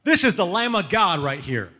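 high, loud call to a crowd; making a big scene. Retold or imitated. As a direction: holler for everybody's attention, acting out the prophet. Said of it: "John the Baptist makes a big scene".

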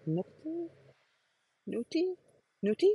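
"Nuti" with a questioning rise; unsure name pronunciation. Shown as a demonstration. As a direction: try out "Nuti" as a tentative guess. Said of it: "how to pronounce his name".

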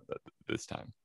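ordinary talk, nothing marked

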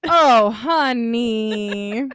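slow, drawn-out loud pity; long sympathetic oh honey; pitying sympathy. Real, not acted. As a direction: draw it out like consoling a friend. Listener pities the story.

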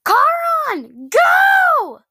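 very high, loud urgent cry; urgent shout. Acted. Rider sees fireballs coming, urgent high shout. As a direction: shout to get the dragon moving. Urging dragon away from fireballs.

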